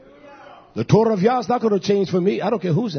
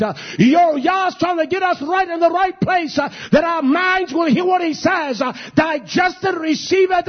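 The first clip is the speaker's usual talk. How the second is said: shouting the sermon, pitch raised high; preaching at full force; 7 semitones higher than usual. Real, not acted. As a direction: drive it home to the church. Preacher rising to a full cry.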